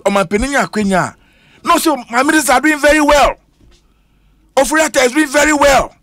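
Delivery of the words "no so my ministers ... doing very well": higher, a mock-smug official voice; mocking the president. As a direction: parrot the president's self-praise with a light sneer. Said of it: "How can the president be talking like that?".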